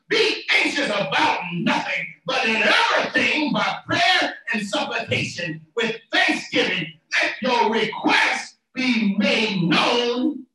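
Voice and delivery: forceful verse recitation, stretched at the end; preaching. Real, not acted. Preacher reciting Philippians to the congregation, preaching. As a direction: build the verse, drawing out the last words.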